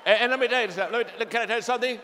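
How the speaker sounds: big pulpit voice setting up the punchline; preaching build-up. Acted. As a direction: lift it up and push it out to the crowd.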